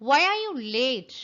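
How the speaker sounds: ordinary talk, nothing marked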